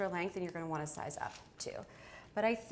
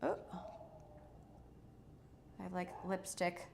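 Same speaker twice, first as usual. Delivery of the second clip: ordinary talk, nothing marked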